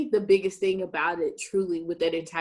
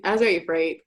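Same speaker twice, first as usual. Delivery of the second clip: ordinary talk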